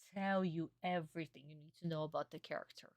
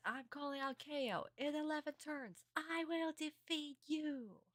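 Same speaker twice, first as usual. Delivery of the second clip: hammed-up anime battle cry, pitched high; acted duelist declaration; 10 semitones higher than usual. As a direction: declare it high, slowing down on the final threat. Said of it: "He says".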